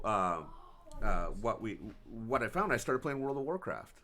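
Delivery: usual speaking voice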